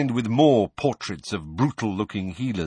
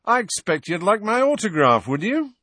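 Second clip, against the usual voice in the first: high, loud, showy offer; vain celebrity; 11 semitones higher, 4 dB louder than usual. A narrator's reading. Former teacher offering his autograph, flamboyant and high. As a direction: gush as if to an adoring fan.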